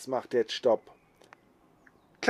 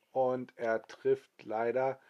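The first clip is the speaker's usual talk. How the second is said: ordinary delivery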